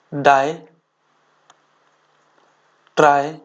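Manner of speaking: word by word with long pauses; measured, halting dictation delivery; slow dictation. Acted. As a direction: dictate slowly for note-takers. Dictates a rule for notes.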